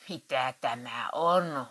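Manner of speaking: slow, menacing demand; furious. Acted. Husband confronts wife over the magazine bill, seething and slow. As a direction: drag it out, seething. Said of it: "hurjaili Matti".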